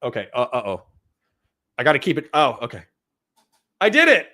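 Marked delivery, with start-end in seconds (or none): 3.8-4.3 loud, high cry of victory; triumphant. Real, not acted. Husband having just opened a pickle jar, triumphant. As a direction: announce your small win proudly.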